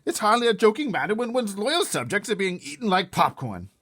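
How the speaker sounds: very high, loud indignant king; testy King. Acted. King of Sweden scolding the Queen, high indignant. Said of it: "growing testy".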